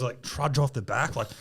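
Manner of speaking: ordinary delivery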